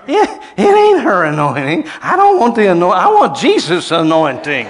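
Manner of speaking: loud, high preacher's cry; fiery preaching at the top of the voice; full-force preaching. Real, not acted. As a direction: preach it out hard.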